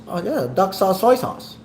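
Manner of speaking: high, lightly voiced comedic impression; playful high-pitched impersonation; Eddie Murphy impression. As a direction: toss off a quick celebrity bit, voice jumping up. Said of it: "That's my Eddie Murphy impression".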